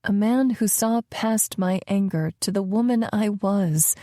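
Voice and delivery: plain reading voice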